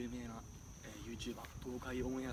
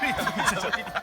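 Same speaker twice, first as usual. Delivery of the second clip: loud laughing protest; cracking up while giving up; 9 semitones higher, 16 dB louder, faster than usual. Real, not acted. Struggling laughing during milk challenge.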